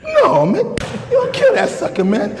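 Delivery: high, laughing burst; laughing exclamation. Real, not acted. Preacher joking to crowd, high laughing burst. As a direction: blurt it out through laughter.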